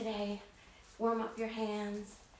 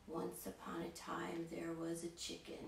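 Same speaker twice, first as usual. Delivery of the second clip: flat boring telling; 3 semitones lower, 7 dB quieter than usual. Shown as a demonstration. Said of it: "I don't just stand up here and go".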